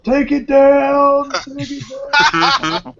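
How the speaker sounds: high, slow mock shout; coke rage yell. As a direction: yell it like a raging maniac. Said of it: "goes on a coke rage and is like".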